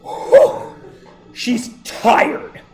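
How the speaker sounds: high unvoiced puff of exhaustion; breathless whew. The doctor, worn out after squeezing, a high breathy whew. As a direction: blow out air like the doctor is spent. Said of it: "she goes like this".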